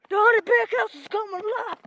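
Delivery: loud, high trailer-style hype; hyped announcement. Real, not acted. Hyped announcement while running.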